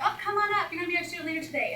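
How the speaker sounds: high, fast, excited welcome; welcoming teacher. Retold or imitated. Coach welcomes the volunteer to the front, high and excited. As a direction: invite the child up with high excitement.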